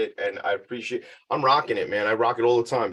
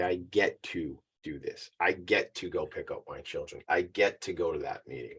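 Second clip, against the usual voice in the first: sincere reframing; 5 semitones lower, 8 dB quieter than usual. Shown as a demonstration. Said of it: "we frame it differently".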